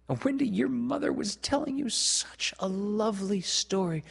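put-on high, wistful boyish enthusiasm; eager delight. A narrator's reading. Peter telling Wendy of her mother's story, high and eager. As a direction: gush about it, pitched well up.

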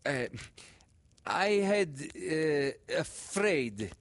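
put-on high, halting child's voice; frank little boy. A narrator's reading. Boy admitting the truth to teacher, high and halting. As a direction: confess it plainly like a small boy. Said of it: "said the monitor of the class goldfish bowl, quite frankly".

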